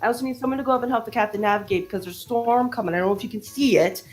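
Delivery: high, fast stream of orders; tiny mouse barking orders. Acted. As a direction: rattle off orders without breathing. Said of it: "barking orders".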